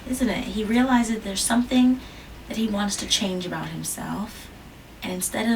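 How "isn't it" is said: usual speaking voice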